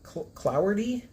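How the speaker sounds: Cloudy said as "KLOW-dee", slow; trying a pronunciation. Shown as a demonstration. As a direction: sound out Cloudy haltingly. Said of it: "I don't think my mouth can move that way".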